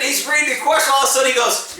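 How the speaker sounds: ordinary talk, nothing marked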